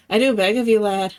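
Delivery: pleading Irish brogue; Irish accent. Acted. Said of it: "the Irish accent that I can use".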